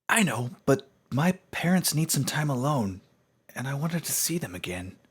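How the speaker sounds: high, loud, eager explanation; earnest young pony. Acted. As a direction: plead your case urgently.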